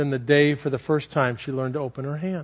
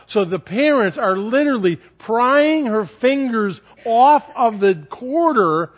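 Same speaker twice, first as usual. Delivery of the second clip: ordinary talk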